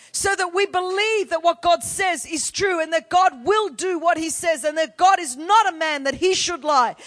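loud, shouted declaration of faith; full-force preaching. Real, not acted. Pastor shouting God's promises to the congregation. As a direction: hammer it home to the church.

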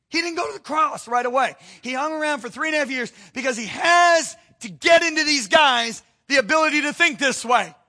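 loud, high, driving sermon climax; fiery preacher voice; preaching at full force. Acted. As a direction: hammer it home to the congregation.

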